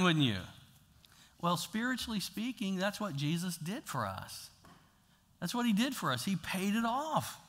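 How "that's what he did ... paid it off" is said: usual speaking voice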